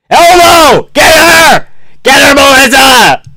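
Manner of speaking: very high, loud cheering; excited yelling. Real, not acted. Fan urging the fighter to attack, excited screams.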